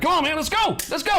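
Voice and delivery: high, urgent pep-talk shout; hype yelling. Acted. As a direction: yell them into action. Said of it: "I'd be yelling".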